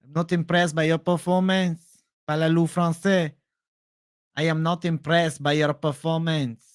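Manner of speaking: put-on French-Canadian accent, changed timbre; GSP impression. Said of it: "G S P impression".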